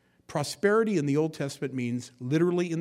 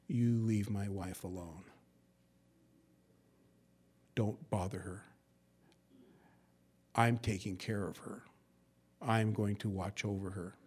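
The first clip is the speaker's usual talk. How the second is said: low protective husband; 4 semitones lower, 6 dB quieter than usual. Retold or imitated. Husband protecting wife, quoted.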